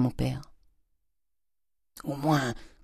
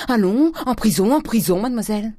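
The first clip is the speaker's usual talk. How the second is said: loud, high-pitched angry command; shouted order; 7 semitones higher, 4 dB louder than usual. A narrator's reading. Father sends daughter to confinement, shouting high and angrily. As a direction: chase them off with a raised voice.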